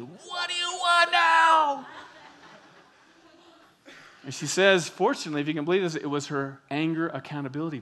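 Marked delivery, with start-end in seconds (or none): 0.0-1.9 high, drawn-out yell; screaming at the phone. Retold or imitated. Mom screaming into the phone at her kids, a furious yell. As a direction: explode at whoever is there. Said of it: "this close to the phone screaming".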